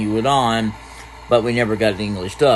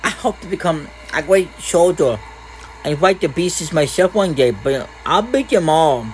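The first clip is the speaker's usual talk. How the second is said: put-on higher, eager boy's voice; childish, pitched-up hero talk; little boy; 6 semitones higher than usual. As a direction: play a boy dreaming of soldiering. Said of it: "Oh, a little boy".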